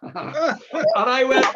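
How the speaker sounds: laughing through the words, stretched and slow; can't hold the laugh back while telling it. Real, not acted. Teller laughing at his own police story, laughing.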